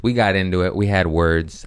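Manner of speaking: ordinary delivery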